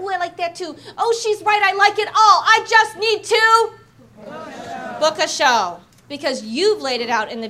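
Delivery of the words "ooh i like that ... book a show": building to a loud, high want; excited customer getting louder; eager guest. Retold or imitated. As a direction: guest talking herself into it.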